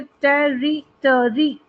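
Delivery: Territory slowly as टे-री-ट-री; four separated beats on territory; syllable by syllable. Shown as a demonstration. Teacher listing four-syllable words, syllable by syllable. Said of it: "four syllables वाले word".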